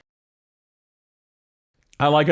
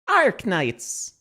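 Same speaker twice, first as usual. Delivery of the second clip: higher-pitched copy of her American "Arknights"; imitated American character voice; 6 semitones higher than usual. Streamer mimicking how the character says Arknights, higher American imitation. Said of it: "pronounces Arknights".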